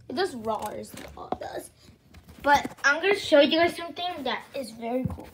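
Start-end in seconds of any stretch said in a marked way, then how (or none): 0.1-1.7 whispering. Real, not acted. Quiet aside about toy.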